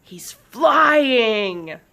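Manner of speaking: loud, high, stretched-out cheer; bubbly cheer. Acted. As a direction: cheer it with delight, drawing out the last word. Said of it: "Blades cheered, her voice bubbly".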